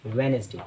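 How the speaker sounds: "वेडनेसडे" as wen-es-day; correct pronunciation. Shown as a demonstration. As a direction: repeat "वेडनेसडे" without the first d. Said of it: "इसमें डी silent होता है".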